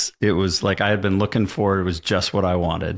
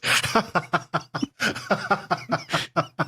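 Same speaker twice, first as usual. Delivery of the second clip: long laugh; 9 semitones higher, 5 dB quieter than usual. Real, not acted. Long laugh after story.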